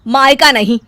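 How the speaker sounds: loud, cutting rebuke; harsh mother-in-law reprimand. Acted. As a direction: put her in her place.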